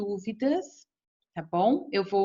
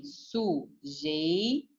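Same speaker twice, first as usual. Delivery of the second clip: slow, softer sujeito drawn out by syllable; word said slowly; 4 semitones lower, 5 dB quieter, much slower than usual. Shown as a demonstration. Teacher to class, slowly spelling out "sujeito". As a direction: say "sujeito" slowly, lingering on jei.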